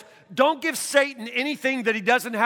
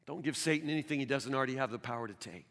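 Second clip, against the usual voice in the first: hushed refrain; 10 semitones lower, 13 dB quieter, faster than usual. Real, not acted. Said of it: "repeated refrain dropped low".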